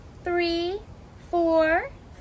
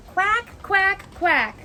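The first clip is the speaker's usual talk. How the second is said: bright-pitched happy quacking; happy duckling; 5 semitones higher, slower than usual. Acted. Ducky Duckling says when feeling happy, cheerful quacking.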